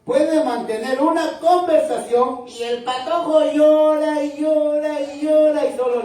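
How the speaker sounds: hammed-up impassioned sermon; preaching at full force. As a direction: proclaim it to the congregation. Preaching with raised voice.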